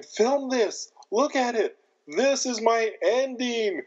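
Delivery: high, slow rapturous madman; exalted madman. Retold or imitated. Albert to the cameraman, rapturous at the burning house, in a high slow voice. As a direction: savor every word of it.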